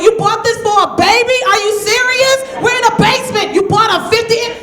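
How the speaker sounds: high, loud indignant disbelief; high loud outrage over a huge TV; outraged disbelief. Retold or imitated. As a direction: freak out at the gift. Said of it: "I was like".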